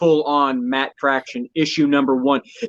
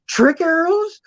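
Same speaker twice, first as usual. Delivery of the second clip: high, fast, thrilled mock squeal; imitating Kate's excited disbelief; 13 semitones higher than usual. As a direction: gush about the trick arrows like an eager kid. Retelling Kate's excited trailer line.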